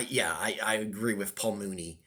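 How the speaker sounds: ordinary talk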